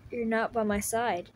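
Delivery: ordinary talk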